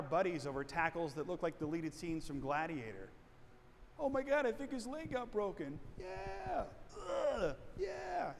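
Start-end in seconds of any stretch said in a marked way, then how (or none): 4.0-8.3 high-pitched whooping sports fan; mock cheering in a high voice; gleeful fan reaction. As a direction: act out a thrilled, grossed-out fan watching a tackle. Acting out fans watching a brutal tackle.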